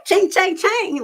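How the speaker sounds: high sing-song ching chang; playful high sound effect; mock ching sound. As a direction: chime it like a register. Imitated cash-register sound.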